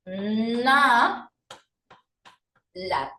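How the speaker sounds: inhalar split into I, na, la, long gaps; I, na, la as separate sustained syllables. Shown as a demonstration. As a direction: draw out each syllable: I... na... la. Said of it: "¿Cómo debe quedar?".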